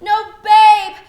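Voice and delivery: loud overwrought stage delivery; hammy declaiming. Acted. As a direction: ham it up for the balcony. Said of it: "Play to the balcony".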